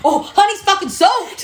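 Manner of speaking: put-on high, loud gleeful toddler; mock toddler announcing; imitating toddler. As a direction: blurt it out like a proud little kid. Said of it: "you just said it like that".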